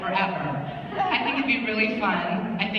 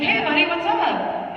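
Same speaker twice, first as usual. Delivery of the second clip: higher, slow, loud hey buddy; excited greeting; 10 semitones higher, 5 dB louder, slower than usual. Retold or imitated. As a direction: hail a friend across the room. Greeting Mark Sheppard in hotel.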